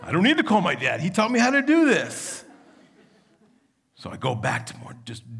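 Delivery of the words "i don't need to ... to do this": louder, much higher, laughing indignant snap; defensive retort. Retold or imitated. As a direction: bristle back at the wife, half laughing.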